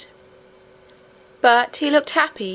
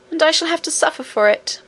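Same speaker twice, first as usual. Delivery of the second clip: higher, mildly tearful complaint; beginning to cry; 6 semitones higher than usual. A narrator's reading. Nurse frets over her punishment, beginning to cry. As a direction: pitch it up with a touch of weepy self-pity. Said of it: "beginning to cry again".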